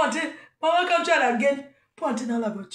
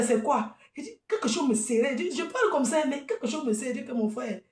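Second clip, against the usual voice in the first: normal talking voice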